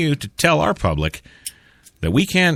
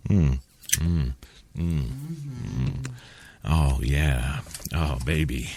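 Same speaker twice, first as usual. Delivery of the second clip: low, soft, sultry purr; sultry moan; 7 semitones lower, 8 dB quieter, much slower than usual. Pretend. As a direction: purr it like a seductive ad. Low sultry ad-style moaning.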